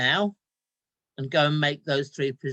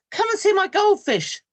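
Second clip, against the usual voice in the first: much higher, louder, bright eager call; eager child; 9 dB louder than usual. Acted. Boy hurries to show mum his goldfish, high eager. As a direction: sell it hard, overly eager to distract. Boy distracts mother from missing dad.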